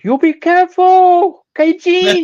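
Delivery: put-on high, loud Japanese-accented warning; hammed-up Japanese accent, high and loud. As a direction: call after him like the sumo wrestler. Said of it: "he's like".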